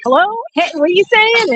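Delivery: mild impatient phone shout; calling out on the phone. Retold or imitated. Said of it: "I'm like".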